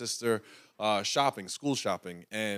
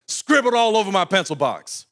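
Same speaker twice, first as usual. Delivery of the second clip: louder, higher, outraged telling; indignant outburst; 8 semitones higher, 8 dB louder than usual. Retold or imitated. Pastor reliving the scribbled pencil box, loud and indignant. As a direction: spit it out like the injustice still stings. Climax of childhood story.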